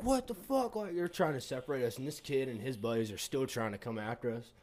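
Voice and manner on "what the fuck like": mock bouncer yell. Bouncers pulling them apart, yelling.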